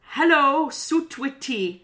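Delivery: loud, hammed-up Welsh hello; a bad Welsh accent attempt, drawn out slow; mock Welsh accent. Shown as a demonstration. Trying a Welsh greeting for her friend, mock Welsh accent. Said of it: "You decipher it".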